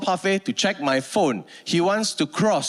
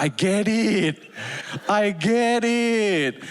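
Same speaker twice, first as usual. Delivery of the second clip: slow, weary I get it; tired acceptance of the grind; world-weary sigh of a line; resigned; much slower than usual. Acted. Weary acceptance, now understanding.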